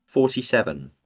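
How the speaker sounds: bingo caller. Pretend. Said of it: "I really need to learn my bingo calls".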